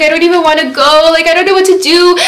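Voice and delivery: high-pitched mock-tearful whine; whiny, tearful replay of herself; retold crying. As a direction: imitate helpless crying. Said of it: "I was just crying".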